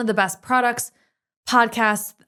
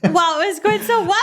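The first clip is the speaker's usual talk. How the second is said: high squeal through laughter; laughing it out, high and loud; 11 semitones higher, 5 dB louder than usual. Real, not acted. Laughing after anchor bit.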